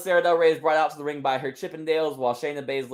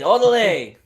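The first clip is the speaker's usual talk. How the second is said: hyped catchphrase shout; 2 semitones higher, 7 dB louder than usual. Acted. Wrestler signs off to the crowd, shouting. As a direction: cry it out to end the promo.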